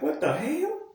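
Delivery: high-pitched disbelief without extra volume; incredulous. Acted. Vlogger reacting to blood going everywhere, incredulous. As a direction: let the pitch shoot up in bafflement.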